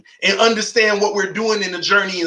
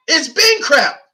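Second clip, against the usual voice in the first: ordinary delivery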